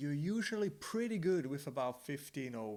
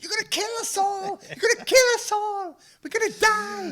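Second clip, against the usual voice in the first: very high, loud hammed-up shriek; mock hysterical screaming passenger; panicked wife; 15 dB louder than usual. Wife screaming at husband overtaking trucks, hammed-up panic. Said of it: "And she's like".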